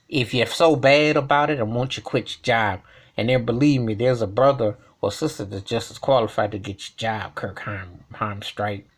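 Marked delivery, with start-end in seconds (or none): none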